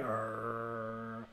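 low, soft zombie groan; showing the dog how a zombie sounds. Pretend. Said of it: "You could be a zombie like".

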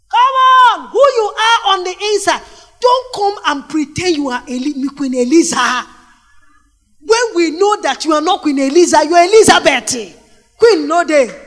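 loud, high shouted preaching; preaching at full force. Acted. Preacher shouting at the congregation, mocking pretenders.

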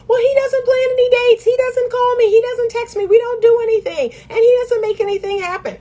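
high, whiny put-on complaint; whiny complaining woman. Coach mocking a woman's DM in a high whine. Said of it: "and then you're D Ming me".

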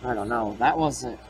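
low hushed unsure murmur; nervous night vlog whisper. Real, not acted. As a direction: mumble it quietly, lost for words. Unsure murmur after spirit reply.

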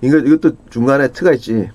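ordinary talk, nothing marked

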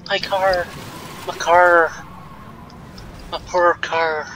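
high, wailing mock-Irish lament; hammed-up Irish cry of dismay; Irish accent. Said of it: "Why did I turn Irish?".

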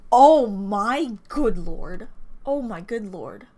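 low, slow, drawn-out exclamation; slow, awestruck exclamation; stunned amazement. Real, not acted. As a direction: stretch it out low, floored. Amazed reaction to the photo.

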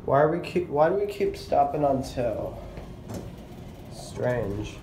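ordinary talk

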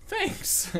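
high, breathy whisper of thanks. Acted. Thanking the pig again, whispered.

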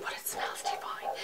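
hushed dreamy whisper; blissful whisper; whispered swoon. Real, not acted. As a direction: breathe it out adoringly. Swooning aside.

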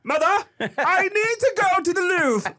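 put-on squeaky, very high British accent; British-accented baby. Baby addressing mother, very high British accent. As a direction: announce it grandly as a British baby. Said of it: "busted out with this British accent".

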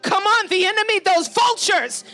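ordinary talk

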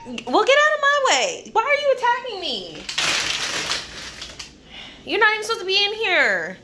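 high, loud scolding at someone in the way; real fed-up shout; exasperated yelling. Real, not acted.

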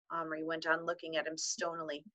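usual speaking voice

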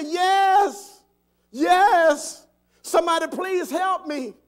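high, loud acted begging; urgent cry up to heaven; yelled plea. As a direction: holler back, desperate for rescue. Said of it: "he yells out".

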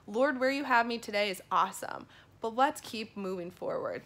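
usual speaking voice

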